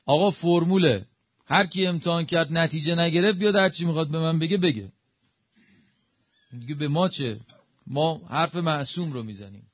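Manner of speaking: ordinary talk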